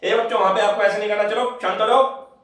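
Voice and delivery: high, loud call to the class; teacher calling out. Retold or imitated. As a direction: shout at the kids from the gate. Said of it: "ये आवाज लगाते रहेंगे".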